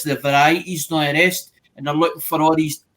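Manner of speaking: ordinary talk, nothing marked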